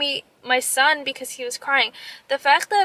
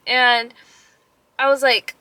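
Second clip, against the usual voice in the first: usual speaking voice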